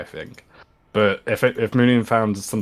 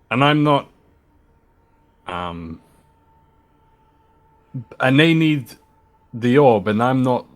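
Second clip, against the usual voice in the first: unconvincing defiance; 4 semitones higher, 5 dB louder than usual. Acted. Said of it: "I'm not sounding very convincing".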